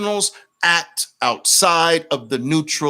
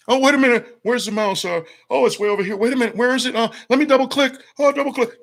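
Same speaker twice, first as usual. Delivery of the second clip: high, silly voice clicking along without a clue; pitched-up mock voice of a bumbling trader; mocking a clueless mouse trader; 10 semitones higher, much faster than usual. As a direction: parody a slowpoke fiddling with the mouse.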